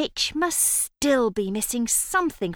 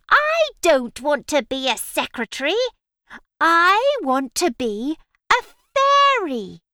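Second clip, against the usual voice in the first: whiny witch; 5 semitones higher, 6 dB louder than usual. Acted. Witch complains about the result.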